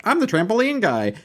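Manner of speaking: high-pitched caricature of a one-gag guy; mock character voice. Host mocking an overdone trampoline gag, high mock character voice.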